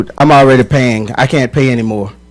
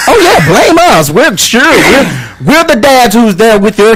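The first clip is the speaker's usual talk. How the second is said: loud, higher-pitched sarcastic protest; indignant and raised in pitch; sarcastic indignation; 6 semitones higher, 6 dB louder, slower than usual. Real, not acted. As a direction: push back with mock outrage. Mock indignation at being blamed.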